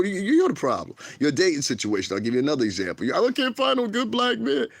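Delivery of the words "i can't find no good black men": high-pitched mocking voice of a complainer; mock complaint. Mocking women's dating complaints, high put-on voice. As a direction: mimic a dater's whine, pitched way up.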